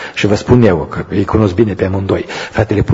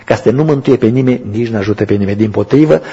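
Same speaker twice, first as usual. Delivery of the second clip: usual speaking voice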